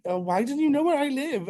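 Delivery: very high, laughing mock outrage. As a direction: giggle through the indignation. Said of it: "And I was like".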